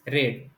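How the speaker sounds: रेड said with short e, like red; past tense read. Shown as a demonstration. Teacher shows students रेड as past-tense read. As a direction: say रेड as red, not reed. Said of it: "लेकिन उसको क्या पढ़ते हैं?".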